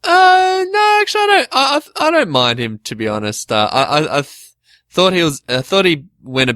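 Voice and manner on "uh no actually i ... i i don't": flustered high pitch. Real, not acted. Flustered reply about JonTron.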